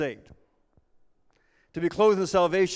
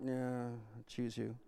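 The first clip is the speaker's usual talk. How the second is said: much softer, slow, unwilling "yeah"; reluctant shrug; 5 semitones lower, 12 dB quieter than usual. Acted. Captain picking the last kid, bored and soft.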